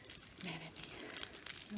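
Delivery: slow, soft whisper; slow whispered remark about the angry bird; whispered aside. Real, not acted. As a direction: breathe it out under the breath.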